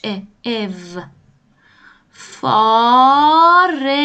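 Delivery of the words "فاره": فاره stretched slowly and loud, vowel rounded; careful slow فاره; slow teaching voice. Shown as a demonstration. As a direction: model فاره slowly for the class. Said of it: "ولی این او هستش".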